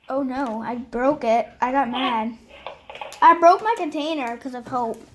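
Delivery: ordinary delivery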